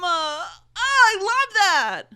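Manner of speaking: loud, high fake gush; mock swooning. Real, not acted. Mocking men doing the bare minimum with a loud, high fake swoon. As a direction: gush sarcastically over nothing.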